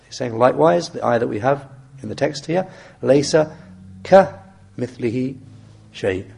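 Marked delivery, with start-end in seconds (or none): 3.0-6.3 كَمِثْلِهِ broken after its first syllable "ka"; kaf set apart. Shown as a demonstration. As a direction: isolate the "ka" of كَمِثْلِهِ with pauses. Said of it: "that kaf there is for emphasis".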